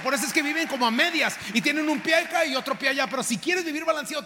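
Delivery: loud, hammering cien por ciento; predicación a todo pulmón; preaching at full force. Acted. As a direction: drive each line home to the church. Preaching climax.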